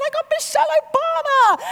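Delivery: high, loud starstruck gush; breathless celebrity-struck squeal; giddy fan. Pretend. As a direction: gush like you've just met a star. Excited meeting a celebrity.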